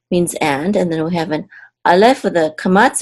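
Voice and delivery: ordinary delivery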